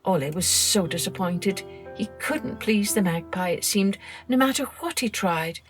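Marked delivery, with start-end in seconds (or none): none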